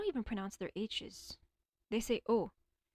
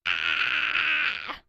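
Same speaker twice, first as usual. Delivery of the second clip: loud high yelp of alarm; sudden shout; 6 semitones higher, 10 dB louder than usual. Real, not acted. Streamer yelps loudly at her screen. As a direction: let out a sharp startled yell.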